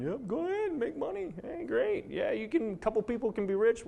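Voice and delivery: high, biting mock cheer; sarcastic. Real, not acted. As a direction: pitch it way up in scornful fake approval. Sarcastic about fracking profits.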